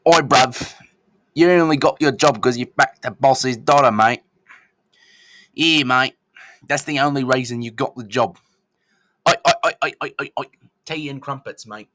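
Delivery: loud, hammed-up mock British accent like a taunting wrestling promo. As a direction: shout the taunt in an over-the-top British accent. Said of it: "And they're like".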